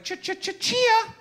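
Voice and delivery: high, loud stuttered chia jingle; jingle imitation. Comic telling how hair sprouted overnight, imitating a high jingle. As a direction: sputter out a high, punchy jingle.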